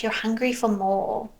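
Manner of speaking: ordinary delivery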